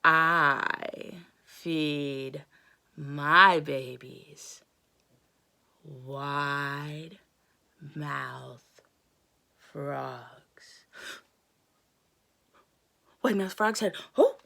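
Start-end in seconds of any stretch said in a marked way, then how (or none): 0.0-10.9 low, slow crocodile voice; menacing crocodile. Acted. Crocodile telling the frog what she eats, low and slow. 14.2-14.4 high, loud startled frog; alarmed squeak. Acted. Frog realizing the danger, high startled squeak.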